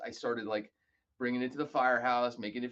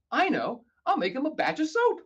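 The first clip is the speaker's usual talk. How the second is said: higher and louder, excited; bright idea; 8 dB louder than usual. Retold or imitated. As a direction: sell the sudden brainwave. Sudden idea, retold.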